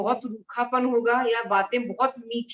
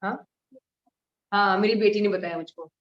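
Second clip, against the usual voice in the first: ordinary delivery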